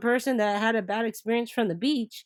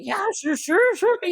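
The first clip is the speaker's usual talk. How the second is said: high, eager, laughing yes; imitating the driver's thrilled, much higher agreement; excited; 13 semitones higher than usual. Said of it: "He was all excited".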